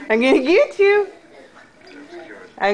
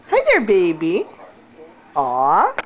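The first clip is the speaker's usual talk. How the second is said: ordinary talk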